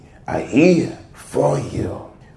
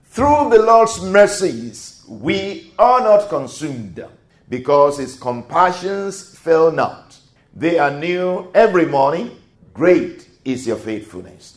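fervent declaration; 5 semitones higher, 5 dB louder than usual. Shown as a demonstration. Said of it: "Let's do that again".

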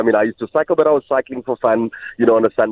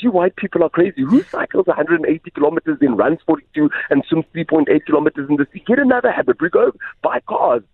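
higher, lively mock-incredulous rant; playful impression of a friend scoffing, pitched up; a friend mocking the Ironman habit, higher scoffing voice; Andre's retort; 7 semitones higher than usual. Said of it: "He says".

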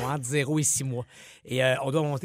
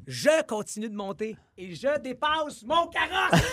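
excited; 8 semitones higher, slower than usual. Real, not acted. Climax of escalator story.

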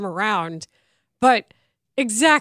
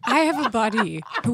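usual speaking voice

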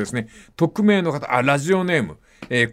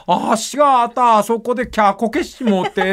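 higher mock shriek inside the Kumamoto phrase; Kumamoto dialect with shriek; 6 semitones higher than usual. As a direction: throw in a little kya shriek, Kumamoto dialect. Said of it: "ちゃんと悲鳴も入れる".

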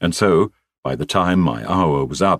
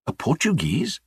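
high rising mock astonishment; mock surprise; 10 semitones higher, 4 dB quieter than usual. Student to his boss, pretending to be amazed by the news. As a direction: act innocently amazed.

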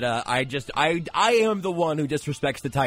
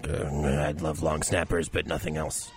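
lower, quieter Belichick impression with a deadpan mumble; Bill Belichick; 8 semitones lower, 4 dB quieter, slower than usual. Joking about picks to co-hosts, low deadpan Belichick impression. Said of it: "That's my Bill Belichick impression".

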